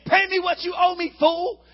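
loud, high threatening snarl; angry demand. Acted. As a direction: grab him by the throat and demand it. Servant chokes fellow servant demanding money.

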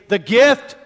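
high, loud call to the room. Shown as a demonstration. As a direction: call it out for the crowd to repeat. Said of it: "And I want you to say".

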